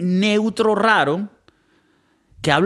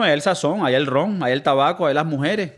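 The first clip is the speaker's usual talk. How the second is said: ordinary talk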